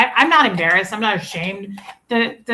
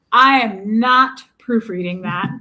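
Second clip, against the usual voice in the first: slow, louder retold refusal; mock emphatic declaration; 6 dB louder, slower than usual. Retelling Tommy giving up on texting, emphatic imitation. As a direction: declare it loudly, word by word.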